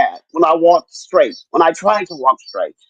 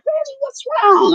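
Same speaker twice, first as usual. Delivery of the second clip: put-on high, worried little boy's voice; imitating grandson; 3 dB quieter than usual. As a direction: ask like an anxious child. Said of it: "he was like".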